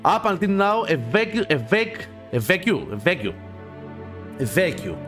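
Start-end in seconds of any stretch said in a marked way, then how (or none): none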